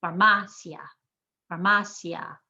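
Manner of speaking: "farmacia" stressed far-MA-sia; IA as one sound. Shown as a demonstration. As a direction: lean on MA in "farmacia" and blend the final "ia". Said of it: "They're one sound".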